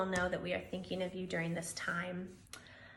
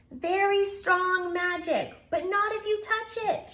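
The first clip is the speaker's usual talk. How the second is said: shouted warning; 9 semitones higher, 4 dB louder than usual. Acted. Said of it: "shouted Bear".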